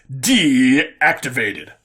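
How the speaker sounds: very slow, drawn-out machine voice; slow shutdown. Acted. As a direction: wind down like a dying machine. AI shuts down.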